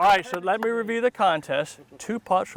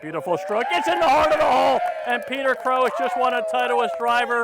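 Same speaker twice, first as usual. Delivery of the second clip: loud, high, fast excited play-by-play; excited call; 7 semitones higher, 5 dB louder than usual. Real, not acted. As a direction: call the winning putt with rising thrill. Live call of winning putt.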